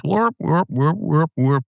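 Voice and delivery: high, wordless wah-wah droning; Charlie Brown teacher. Retold or imitated. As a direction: imitate muffled cartoon grown-up babble. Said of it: "the teacher on the Charlie Brown Snoopy show".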